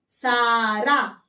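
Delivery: सारा drawn out very slowly; very slow model word. Shown as a demonstration. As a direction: stretch सारा out, syllable by syllable. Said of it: "ऐसे".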